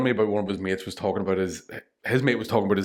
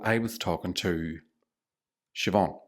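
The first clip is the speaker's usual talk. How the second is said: slow, soft mom impression; lightly voiced mom; 2 semitones lower, 5 dB quieter, slower than usual. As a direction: mimic mom starting a story. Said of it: "I do a really good impression of her".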